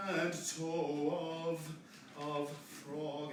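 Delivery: of said with a clear V before frog. Shown as a demonstration. Director modelling diction of of frog. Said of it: "The F is a V sound".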